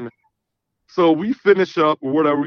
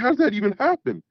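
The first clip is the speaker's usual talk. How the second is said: incredulous; 9 semitones higher, faster than usual. Retold or imitated. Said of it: "I was like".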